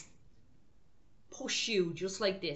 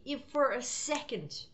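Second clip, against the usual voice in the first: normal talking voice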